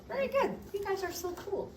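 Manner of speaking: delighted praise. Real, not acted. Teacher praising students after a perfect drill, delighted high praise. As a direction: gush over the class like a proud teacher.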